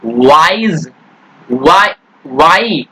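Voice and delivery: वाइज slow and low, its one vowel glide stretched; slow demonstration of the vowel in वाइज, वाई; drawn-out vowel sound. Shown as a demonstration. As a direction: stretch वाई to show the ee at its end. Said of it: "ई आ रहा ना यहाँ पर".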